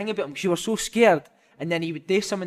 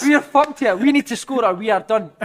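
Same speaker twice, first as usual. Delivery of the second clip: loud, high, panicked warning to teammates; laughing through a doomed cry; mock panic; 9 semitones higher, 12 dB louder, slower than usual. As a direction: retell it as a loud, hammed-up panic. Panicked player telling teammates in story.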